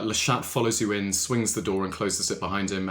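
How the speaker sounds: normal talking voice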